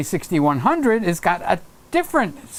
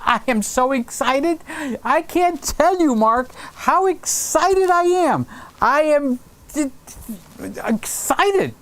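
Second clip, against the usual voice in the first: squealing high, gushing over the cameras; giddy fake-breathless excitement; mock excitement; 8 semitones higher, slower than usual. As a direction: overplay the thrill, high and stretched. Answering Mark about new cameras.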